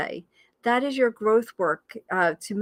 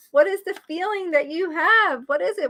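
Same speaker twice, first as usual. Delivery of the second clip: usual speaking voice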